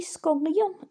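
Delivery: scholion slow and clearly Greek; Greek word. Shown as a demonstration. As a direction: model scholion for the class.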